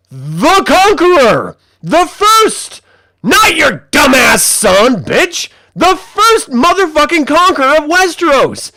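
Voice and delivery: high, loud, outraged mock declaration; over-the-top indignant yelling; hammed-up shout. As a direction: proclaim it with exaggerated fury, drawing out key words. Acted outraged correction in retold argument.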